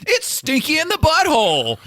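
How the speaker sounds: high, loud mock radio host announcement; goofy radio voice. Cohost spoofing a radio intro, hammed-up DJ voice. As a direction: announce it like a cheesy DJ. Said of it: "I had a different idea with radio voice".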